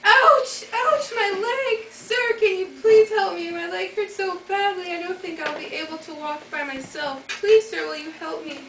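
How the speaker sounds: put-on loud high pleading old woman; hammed-up cries of an old crone; old lady in pain. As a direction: fake the hurt leg to lure him in. Witch in disguise feigns injury.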